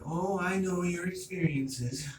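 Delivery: a hint of a knowing mother, drawn out; mock mom. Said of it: "I'm like".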